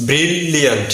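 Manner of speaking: ব্রিলিয়ান্ট with a held double L; correct pronunciation. Shown as a demonstration. Teacher restating it for learners, correct bril-liant. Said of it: "সঠিক উচ্চারণ হবে".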